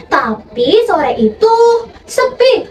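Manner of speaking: plain reading voice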